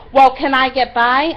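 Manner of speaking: loud, high mock demand; pushy mimicry. Pushy woman asking to pass on the bus, loud mimicry.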